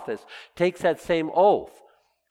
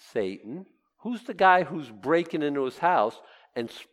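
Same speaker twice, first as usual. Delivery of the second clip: ordinary delivery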